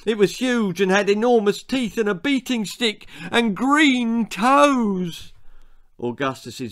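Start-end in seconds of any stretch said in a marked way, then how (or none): 0.0-5.3 breathless tall tale. A narrator's reading. Boy telling tall tale.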